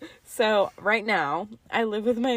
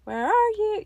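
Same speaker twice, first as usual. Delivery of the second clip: imitating toddler; 11 semitones higher, 3 dB louder than usual. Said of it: "look at the dirt and go".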